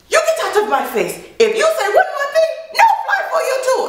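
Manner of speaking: high, fast mock tirade from the gate agent; shrill agent yelling. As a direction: squeak out the angry agent's threats.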